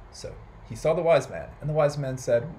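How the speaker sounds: ordinary delivery, nothing marked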